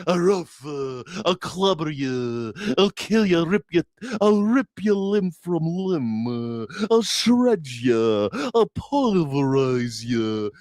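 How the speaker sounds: slow, mock-tough threats from the cowardly lion; blustering lion. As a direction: puff up and growl out empty threats. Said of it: "at first he's like".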